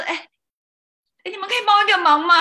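high, loud and rushed favour ask; mock panicked ask to wedding guests for a favour; hurried plea. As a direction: blurt it out before everyone leaves. Acted last-minute plea at wedding table.

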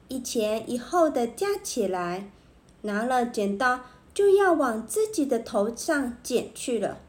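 plain reading voice

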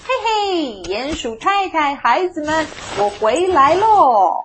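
high, loud homecoming call; shouting out. A narrator's reading. Mole greeting wife and children, shouting out. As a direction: call out to the family, stretching the last word. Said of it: "鼹鼠先生钻出头大叫".

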